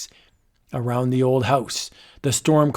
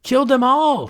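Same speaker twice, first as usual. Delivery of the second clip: high, frenzied triumphant cry; mad shriek; 10 semitones higher than usual. Acted. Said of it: "Scroon shrieked".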